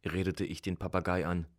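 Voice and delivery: usual speaking voice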